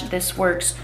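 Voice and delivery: ordinary delivery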